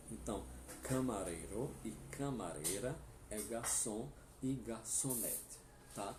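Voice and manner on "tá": normal talking voice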